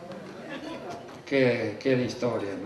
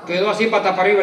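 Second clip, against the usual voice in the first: ordinary talk, nothing marked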